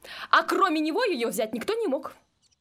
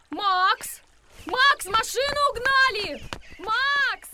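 calling out; 7 semitones higher, 3 dB louder, much slower than usual. Acted. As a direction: shout the name urgently. Calling out, car stolen.

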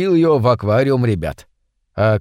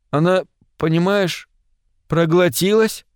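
halting, higher-pitched admission; sheepish confession; 4 semitones higher than usual. Acted. Витя admits to his friend the fish got swallowed, sheepish.

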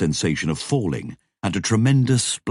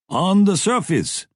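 called out; 14 semitones higher, 4 dB louder than usual. A narrator's reading. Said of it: "Dev called".

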